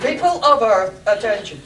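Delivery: loud, booming alien ruler; Martian overlord voice. Acted. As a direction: boom it out like an alien king. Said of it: "I think we've lost the dramatic thread".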